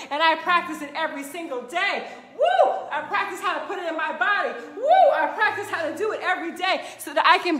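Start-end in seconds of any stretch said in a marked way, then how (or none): 2.4-2.7 high whoop; excited high woo; cheering woo. Real, not acted. Cheering herself on. 4.8-5.1 high whoop; excited high woo; cheering woo. Real, not acted. Cheering herself on.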